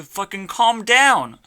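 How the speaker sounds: yelling calm down, voice cracking high; shouted. Real, not acted. Ranting alone on camera, bursting into a loud yell. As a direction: blows up on the last word. Ranting to himself about calming.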